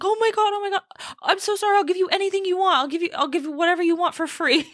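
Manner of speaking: high, rushed mock apology; upset, high-pitched over-apologizing, imitated; frantically apologetic. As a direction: imitate a panicked guy begging forgiveness. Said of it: "he was so apologetic, so upset".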